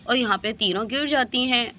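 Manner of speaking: usual speaking voice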